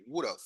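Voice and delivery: "would have" run together as "would'a"; American reduced would have. Shown as a demonstration. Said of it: "Repeat after me". ("would have" pronunciado como "wuda")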